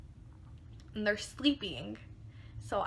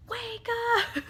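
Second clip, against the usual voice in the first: high, loud mock holler; imitated wake-up yell; 13 semitones higher, 4 dB louder than usual. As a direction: call through a shut door like a banging friend. Friend banging on door like police.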